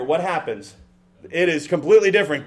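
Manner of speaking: ordinary talk, nothing marked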